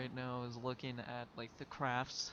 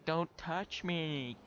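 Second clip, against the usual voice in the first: drawn-out, higher, louder mock scream; imitated scream; 4 semitones higher, 5 dB louder, much slower than usual. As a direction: mimic the grumpy lady screeching slowly. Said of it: "like, screaming".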